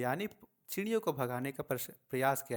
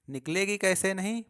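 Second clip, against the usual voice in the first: sharp, higher and louder retort; indignant retort; 6 semitones higher, 5 dB louder than usual. A narrator's reading. Father refuses to accept the birds stay, raising his voice. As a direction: snap it back, stung. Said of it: "पिताजी बोले".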